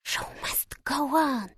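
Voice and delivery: slow hissed whisper in the ear. A narrator's reading. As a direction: hiss it, slowly, close to the ear. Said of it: "syknęła mi do ucha".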